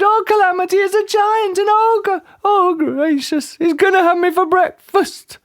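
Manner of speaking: very high hysterical wail; screamed. A narrator's reading. Mister Jelly mistaking the tramp for an ogre, screaming. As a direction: scream in squeaky panic. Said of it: "he screamed".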